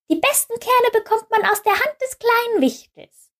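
hohe, piepsige Vogelstimme. Acted. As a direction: sprich wie ein kleiner zwitschernder Vogel. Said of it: "sagten die Vögel". (hohe Vogelstimme)

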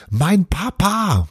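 put-on high excited child cry; high joyful child's yell; boy's shout. A narrator's reading. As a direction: shout it out as the boy spots his dad. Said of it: "schrie Bruno".

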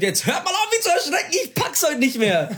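high, put-on whiny plea; half-laughing, begging them to stop scaring me; exasperated mock cursing. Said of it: "hab zum Schluss geflucht". (gespielt jammernd, viel höher, genervt fluchend)

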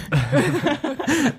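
real laugh jumping high; high amused laughter. Real, not acted. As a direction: laugh out high at your own joke. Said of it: "laughs after joke about moving".

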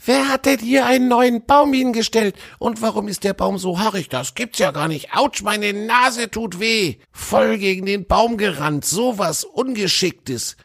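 squeaky high voice, scolding to himself; a little squirrel grumbling after bumping his nose. A narrator's reading. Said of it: "eine hohe Stimme, die vor sich hinschimpfte".

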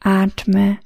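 ordinary talk, nothing marked

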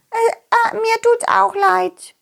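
very high, slow worm voice; timid little worm. Acted. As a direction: squeak out a shy apology. Second worm apologizes.

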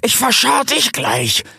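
high, slow hissing snarl; hissing threat. Acted. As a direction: hiss the threat out. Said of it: "fauchte Rix".

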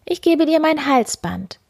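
high, eager call; the miller's daughter quickly offers her necklace. Acted. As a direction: blurt it out hopefully. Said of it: "rief die Müllerstochter".

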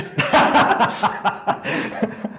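hohes, herzhaftes Auflachen; lautes Lachen. Real, not acted. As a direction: lach laut los. Herzhaftes Lachen nach Pointe. (herzhaftes Lachen)

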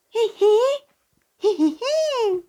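very high, slow squeaky giggle; tiny creature giggle. Acted. Balli giggles in the cold snow, very high and squeaky.

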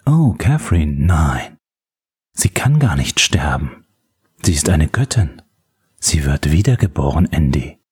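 lightly voiced low, hushed villain; a hint of a menacing caller; sinister caller. A narrator's reading. Parker tells Andy about his goddess, lightly sinister.

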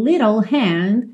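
American "little", the T's tapped; American pronunciation. Shown as a demonstration. Teacher contrasting with British, American "little" with tapped T. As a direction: say "little hand" the American way, "liddle". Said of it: "Mas no inglês americano".

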